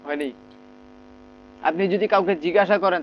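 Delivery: ordinary delivery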